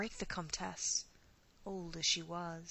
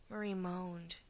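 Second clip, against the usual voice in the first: ordinary delivery, nothing marked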